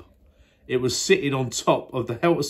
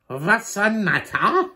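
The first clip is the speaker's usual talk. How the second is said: odd foreign voice; 10 semitones higher, 3 dB louder, slower than usual. Acted. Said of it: "I'm not quite sure what that was".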